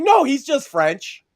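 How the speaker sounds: wife's answer. Retold or imitated. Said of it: "She's like".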